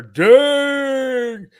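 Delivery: high, loud burst of surprise; exclaiming. Real, not acted. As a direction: blurt it out loud. Reacting to sitting in chair.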